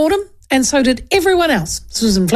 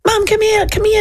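put-on child calling mum; 11 semitones higher than usual.

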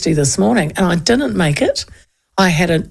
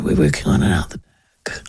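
much lower, dull cafe worker; a mild take-off of a glum server; glum mumble; 3 dB quieter than usual. Retold or imitated. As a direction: drone it like a bored worker.

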